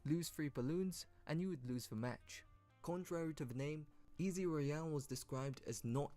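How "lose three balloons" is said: ordinary talk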